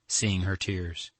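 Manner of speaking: plain reading voice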